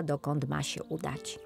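ordinary delivery, nothing marked

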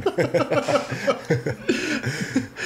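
high, sustained laughing fit; long high-pitched laugh; real laughter. Real, not acted. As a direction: crack up at your own line. Laughing at own cocks remark.

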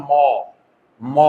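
ম said as "maw" with an open vowel; standalone letter sound. Shown as a demonstration. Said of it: "একটা অয়ের উচ্চারণ ছিল".

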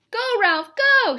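high, loud cheering; shouted cheer. A narrator's reading. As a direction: cheer him on like a whole class. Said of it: "shouted the class".

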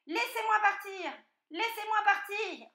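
high, loud squealed plea; piglet yelling. A narrator's reading. Struggling piglet to the fox, high panicked yell. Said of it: "Le porcelet gigotait et hurlait".

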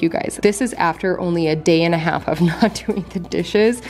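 ordinary talk

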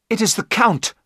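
high-pitched alarmed exclamation; horrified cry. A narrator's reading. Jonathan recognising the Count in the street, crying out. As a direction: gasp it out high, in horror. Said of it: "Jonathan gave a terrible cry".

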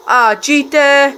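high, loud and slow; outraged accusation. Real, not acted. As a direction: drag it out in disbelief. Gamer accusing opponent.

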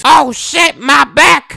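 high-pitched comic yell; mock pained scream. Old man trying to catch a meteor, high mock scream. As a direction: cry out high like your back just went. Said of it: "and screams".

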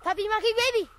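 high loud chant; shouted catchphrase. Pretend. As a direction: blurt it out loudly.

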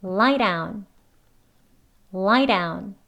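DOWN starting on a quick tapped r; flapped d. Shown as a demonstration. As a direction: say "lie down" as "lai-raun". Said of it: "이렇게 발음합니다".

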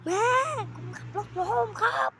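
high, loud desperate shout; shouting for mom. Pretend. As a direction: yell it so mom hears. Calling mom again, no answer.

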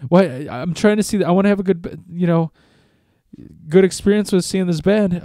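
high, distressed mock pleading; whiny upset pleading. Crying man begging his brother for support, hammed-up upset pleading.

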